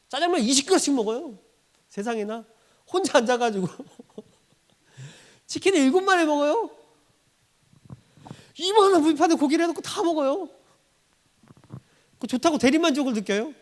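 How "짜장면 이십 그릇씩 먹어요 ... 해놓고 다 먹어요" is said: high, excited disbelief at the eating; incredulous preacher gushing; amazed exclaiming. Acted. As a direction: marvel at it, voice up and rising. Amazed at mukbang eaters.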